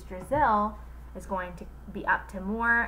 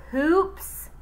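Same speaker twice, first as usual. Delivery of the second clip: higher, louder startled question; puzzled question; 9 semitones higher, 5 dB louder than usual. Acted. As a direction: Eric Ovens hearing the odd name, surprised. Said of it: "asked Eric Ovens".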